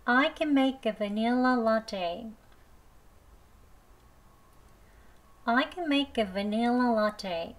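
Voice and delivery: the sentence spoken very slowly, "vanilla" with an i, "va-NIL-la"; slow, careful model reading. Shown as a demonstration. Teacher reading the practice line slowly, i version. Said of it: "발음 두 번 해보겠습니다".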